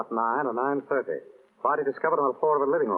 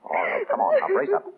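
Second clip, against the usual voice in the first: high and loud, pushing her to pull herself together; urging her on; 5 dB louder than usual. Acted. Nick to crying Dorothy, urging her to brace up, high and loud. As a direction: snap her out of it firmly. Comforting a sobbing girl.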